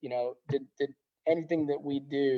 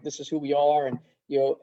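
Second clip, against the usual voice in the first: normal talking voice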